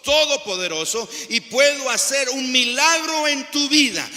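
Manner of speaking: loud, high, driving sermon as God; full-force preaching. Acted. Preacher voicing God calling the congregation to trust, full-force preaching.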